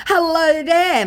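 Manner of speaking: high, loud sugary "Hello there"; hammed-up scammer greeting; mock fake PR voice. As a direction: put on a bright fake receptionist. Reading scammer's message.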